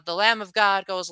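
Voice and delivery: ordinary delivery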